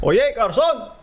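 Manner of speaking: high loud call for the waiter. Pretend. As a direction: summon the waiter across the room. Mock summoning a waiter.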